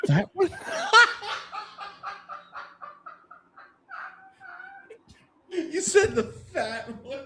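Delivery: quoting through real laughter; can barely get the words out for laughing. Real, not acted. Cohost repeats the Al Davis joke, laughing hard.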